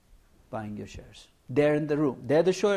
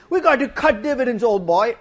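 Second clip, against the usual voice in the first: loud, high hammed-up Oxbridge accent; a lightly done posh English accent; 7 semitones higher, 8 dB louder than usual. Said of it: "My Oxbridge accent is not that good".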